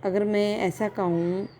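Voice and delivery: usual speaking voice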